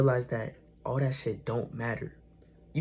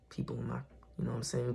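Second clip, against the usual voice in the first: real whisper, much softer; hushed whisper; 11 dB quieter, faster than usual. Real, not acted. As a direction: drop to a whisper so the brother won't hear. Brother came in while recording.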